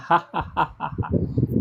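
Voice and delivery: breathy throat laugh showing the guttural sound; guttural laugh demo. Shown as a demonstration. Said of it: "pronunciado como um R de, da risada". (risada gutural de demonstração)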